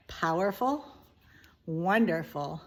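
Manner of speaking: usual speaking voice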